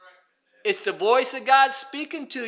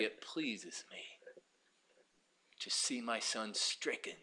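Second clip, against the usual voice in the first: breathy near-whisper, much softer; hushed voice of God; 12 semitones lower, 19 dB quieter, slower than usual. Acted. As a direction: let God's words fall to a whisper.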